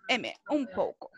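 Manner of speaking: "un poco" con "un" cerrado en M. Shown as a demonstration. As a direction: cierra "un" en M antes de "poco". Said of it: "Ahí está". ("un" pronunciado como M)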